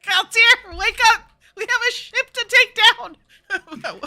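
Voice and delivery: loud, high urgent yell; shouting to wake. Acted. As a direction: rouse a sleeping friend. Yelling to wake companion.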